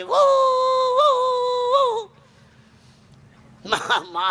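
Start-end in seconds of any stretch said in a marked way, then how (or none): 0.0-2.1 very high, long whooping wail; imitated ambulance-siren baby wail. Baby born in an ambulance crying, high siren-like wail. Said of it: "એકસો આઠમાં જન્મ્યો છે ને".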